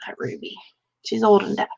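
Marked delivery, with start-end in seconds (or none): 0.1-0.6 hushed aside about the cat; whispered. Real, not acted. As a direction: say it under your breath. Muttering about her cat.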